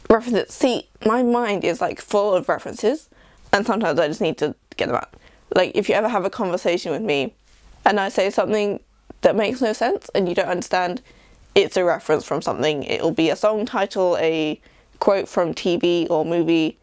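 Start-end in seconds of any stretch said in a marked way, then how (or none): none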